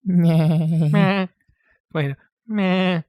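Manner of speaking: bleated cartoon-dad chuckle; Peter Griffin laugh. Co-host attempts Peter Griffin's laugh on request, cartoon impression. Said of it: "what about the Peter Griffin laugh".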